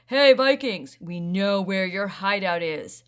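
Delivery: loud taunting yell that eases off. A narrator's reading. Rusty taunting the Vikings by yelling. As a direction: shout the taunt across the trees. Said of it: "they heard Rusty yell".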